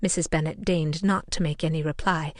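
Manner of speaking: usual speaking voice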